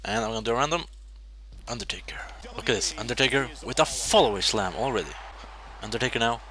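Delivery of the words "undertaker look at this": hushed whisper to himself; quiet whispered aside. Real, not acted. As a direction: whisper it under his breath. Murmuring while playing.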